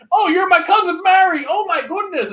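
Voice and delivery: high, loud exclaiming mock Irish; hammed-up Irish delight. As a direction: gush like an Irish dating show contestant. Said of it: "Irish dating show".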